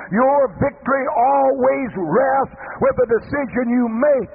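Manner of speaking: preaching that climbs to a high, ringing peak; building sermon climax. Acted. Preacher urging the congregation to make that decision, building sermon climax.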